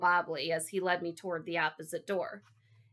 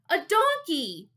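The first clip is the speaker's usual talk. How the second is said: put-on high, loud, drawn-out boy's shout; 11 semitones higher, 7 dB louder, slower than usual. A narrator's reading. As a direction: announce it proudly at full volume. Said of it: "I was shouting again".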